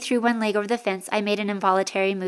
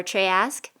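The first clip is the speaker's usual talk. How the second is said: usual speaking voice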